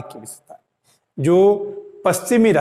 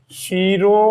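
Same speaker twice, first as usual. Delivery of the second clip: usual speaking voice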